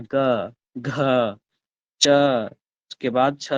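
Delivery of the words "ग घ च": ग, घ, च said one by one, clearly; ग घ च को धीरे धीरे अलग अलग बोलना; sounding out letters. Shown as a demonstration. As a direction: pronounce ग, घ and च slowly, each apart. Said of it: "तो बोल के देखिए ना".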